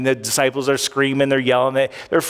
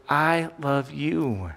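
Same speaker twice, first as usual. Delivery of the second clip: tender whisper-soft; 6 dB quieter, much slower than usual. Retold or imitated. Desperate men longing for words.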